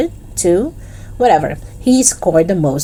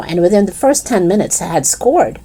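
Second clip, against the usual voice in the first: plain reading voice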